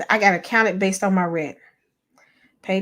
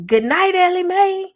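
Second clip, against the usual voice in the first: much higher, louder playful goodnight; sing-song bedtime call from granddad; imitating dad; 13 semitones higher, 6 dB louder than usual. Granddad teases his granddaughter at bedtime, high playful call. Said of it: "he'd be like".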